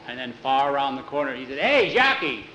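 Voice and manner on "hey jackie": louder, higher hail; calling out. Acted. Jose hails Jackie from far around the corner, calling out. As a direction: call to someone round the corner.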